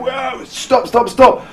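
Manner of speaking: high-pitched, re-enacted panic yell; alarmed shout. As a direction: cry out as if to halt the driver. Reenacting shouted alarm while driving.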